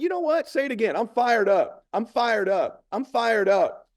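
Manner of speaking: high, punchy repeated chant; hyping up. Retold or imitated. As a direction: re-enact drilling a rallying phrase. Drilling the phrase with daughter.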